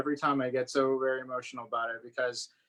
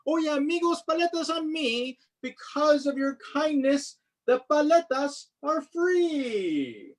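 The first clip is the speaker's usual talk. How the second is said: higher, beaming announcement; cheerful vendor; 12 semitones higher than usual. Acted. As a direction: treat the whole crowd. Vendor announcing free treats.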